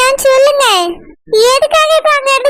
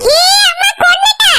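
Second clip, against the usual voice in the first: mock pained cry; 6 semitones higher than usual. Cries out at hard massage.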